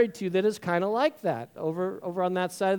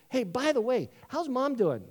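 higher-pitched, suddenly casual question; abrupt subject change; 6 semitones higher than usual. Acted. Brother dramatically changes subject to mom, breezily. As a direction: switch topics with a breezy, higher lift. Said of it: "he changes the subject really dramatically".

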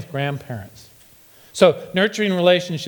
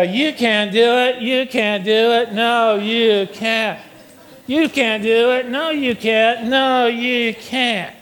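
high, slow, droning mock cheer; pessimistic cheerleader; 5 semitones higher, much slower than usual. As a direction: chant it like a hopeless cheerleader, drawn out. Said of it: "pessimistic cheerleaders".

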